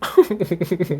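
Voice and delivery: a run of quick giggles; laugh. Real, not acted. Friend amused by the flirting catchphrase, high laugh.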